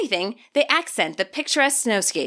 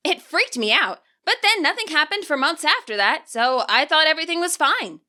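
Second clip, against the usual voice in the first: higher, upbeat schoolgirl voice, reassuring; Nimona, reassuring; 5 semitones higher than usual. Acted. Nimona assures Juliana on Koraidon, upbeat and higher. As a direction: lift the voice into a cheerful young girl.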